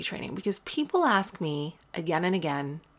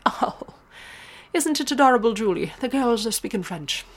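put-on Irish accent; 6 semitones higher, 5 dB louder than usual. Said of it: "That's an Irish accent that I can do".

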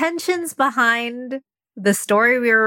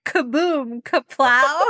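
comic-book sound effects; 3 semitones higher, slower than usual. Shown as a demonstration. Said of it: "Like a comic book".

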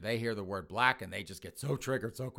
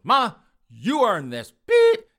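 loud, high, slow furious threat; enraged; 11 semitones higher, 13 dB louder, slower than usual. Acted. Enraged son about to tase his mother, furious shout. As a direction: bellow it as payback. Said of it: "He is so enraged".

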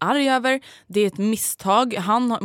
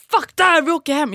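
higher, louder fed-up outburst; imitating angry boyfriend; 7 semitones higher, 5 dB louder than usual. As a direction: mimic him storming off in anger. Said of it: "Han bara".

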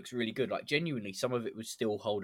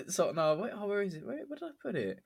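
flustered; 10 semitones higher than usual. Real, not acted. Frantically searching her notes.

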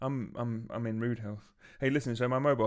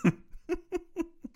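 sudden high-pitched burst of laughter; a squeaky real laugh. Real, not acted. Laughing at the co-host's wind joke, high-pitched burst.

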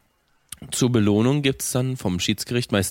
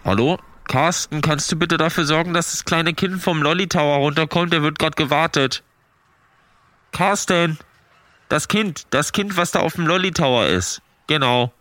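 park loudspeaker announcement; 3 semitones higher than usual. Pretend. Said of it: "ich muss mal ganz kurz eine Ansage machen".

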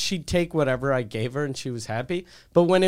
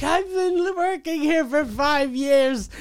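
mock crying; 9 semitones higher than usual. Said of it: "and she was crying".

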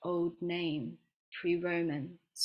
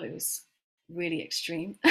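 normal talking voice

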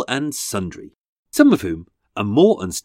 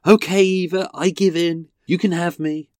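ordinary delivery

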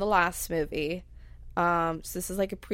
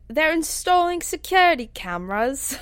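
higher-pitched, hammy Cockney accent; bad Cockney accent; 8 semitones higher, 4 dB louder than usual. Shown as a demonstration. Co-host repeats the line to show the accent, bad Cockney. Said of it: "doing this really bad Cockney accent".

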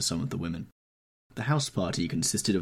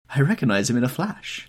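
higher-pitched exclamation from the Duchess; exclaiming; 6 semitones higher, 6 dB louder than usual. A narrator's reading. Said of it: "exclaimed the Duchess".

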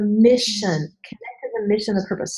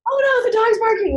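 mock panic; 12 semitones higher, 3 dB louder than usual. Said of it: "we don't go".

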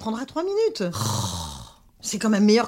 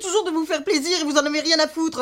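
upset, higher crying voice; tearful; 5 semitones higher than usual. Acted. Mother complains her daughters don't care, tearful. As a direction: pour out the hurt through tears. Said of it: "maman, pleure pas".

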